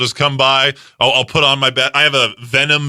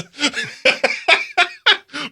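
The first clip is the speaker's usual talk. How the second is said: high giggle; 13 semitones higher than usual. Real, not acted. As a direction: burst of high-pitched laughter. Laughing at yogurt roller idea.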